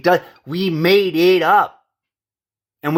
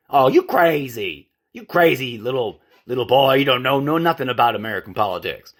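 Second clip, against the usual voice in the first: put-on Southern accent; 3 semitones lower than usual. Commentator imitating critics who called him crazy, mock Southern accent. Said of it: "Southern sounding accent like that".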